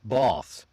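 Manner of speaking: "bath" with a back "ah" as in British English; British long A. Shown as a demonstration. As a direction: say "bath" British-style, close to "bahth". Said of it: "British English might sound a bit more like".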